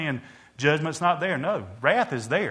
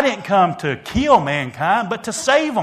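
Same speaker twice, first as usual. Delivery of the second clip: rising preached rebuke; 5 semitones higher, 6 dB louder than usual. Retold or imitated. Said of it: "He rebuked them and said".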